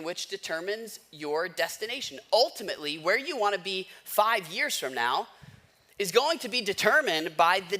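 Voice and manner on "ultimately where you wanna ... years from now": ordinary talk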